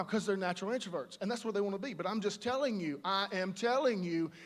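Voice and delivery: usual speaking voice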